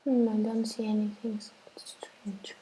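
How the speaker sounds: usual speaking voice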